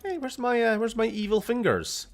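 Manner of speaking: squeaky, playful high voice; high playful call. Pretend. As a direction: call out high for something lost. Playful calling for evil fingers.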